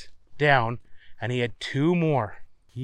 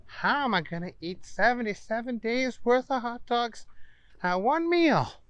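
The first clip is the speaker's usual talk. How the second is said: Booger's voice; 9 semitones higher than usual. Acted. Booger wonders aloud.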